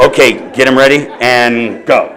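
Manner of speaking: booming director call, laughing through it; loud movie producer. Retold or imitated. Amused speaker booms a director's cue to the room. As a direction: shout it like a producer on set. Imagined producer calling shots.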